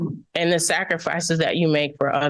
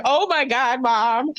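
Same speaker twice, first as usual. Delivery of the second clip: higher-pitched mock exasperation; imitating exasperated kids; 7 semitones higher, slower than usual. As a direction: lightly mimic kids embarrassed by their mother. Voicing embarrassed kids teasing mom.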